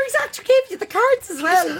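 shouting through laughter; laughing shout. Real, not acted. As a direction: call it out laughing.

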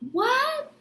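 a sudden high incredulous yelp; incredulous what. Pretend. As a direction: react as if you can't believe how little came back. Incredulous at tiny trickle back.

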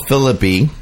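normal talking voice

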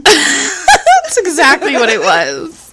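slow words through a real laugh. Real, not acted. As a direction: laugh while agreeing. Laughing agreement.

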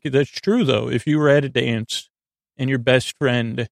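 ordinary talk

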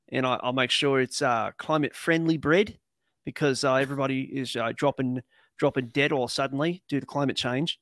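put-on country Australian accent; Bogan Aussie accent. Co-host joking about his Vegemite breakfast, Bogan Aussie accent. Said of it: "that Aussie accent I did, that's Bogan".

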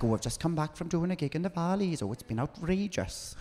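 Welsh valleys accent impression, a touch high; Miss Kitty Welsh accent. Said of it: "Miss Kitty, she's like".

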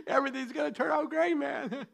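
light breezy preacher impression with a laugh; a soft giggling take on the sunny preacher; mock Joel Osteen. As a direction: play the upbeat TV preacher lightly. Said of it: "But what's his name?".

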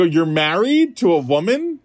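high, comic disbelief; incredulous question. Retold or imitated. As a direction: question it as if it cannot be true. Incredulous reaction to married counselor.

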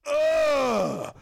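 high, shouted ugh of disgust; disgusted goblin shriek. Acted. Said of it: "And the piss goblin goes".

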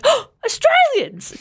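high, drawn-out mock gasp of delight; thrilled retold squeal; excited recognition. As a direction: gasp it high, as if spotting your own people. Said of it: "I was like".

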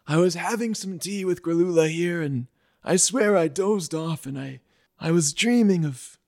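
put-on high, rambling old voice; old Grocky. Old Grocky recounting his dream, high and rambling. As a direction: muse dreamily like an elder.

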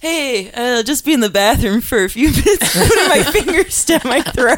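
high, laughing joke voice; playful laughing aside; laughing mock excuse. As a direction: giggle through the fake excuse.

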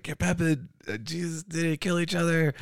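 high-pitched mock exclamation; imitated tour crew. Voicing tour crew's relief at end.

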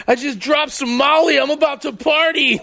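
slow, high, goofy voice of a guy on Molly; high party guy. Retold or imitated. Friend mocks a guy arriving high, slow and high-pitched. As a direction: ham up a guy who just took Molly.

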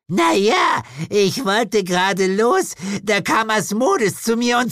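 very high, loud, whiny witch voice; helpless witch. A narrator's reading. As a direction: plead helplessly in a squeaky-high voice. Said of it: "machte einen hilflosen Eindruck". (hilflose Hexe in hoher Stimme)

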